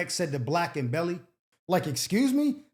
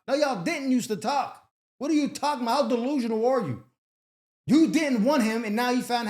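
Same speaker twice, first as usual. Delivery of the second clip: incredulous scolding; 4 semitones higher than usual. Real, not acted. Host scolds caller directly.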